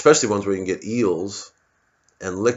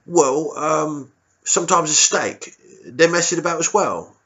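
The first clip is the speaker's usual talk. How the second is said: high, timid squaddie voice; a nervous young private answering his officer; meek soldier squeaking up to the colonel; 7 semitones higher, 4 dB louder, slower than usual. Retold or imitated.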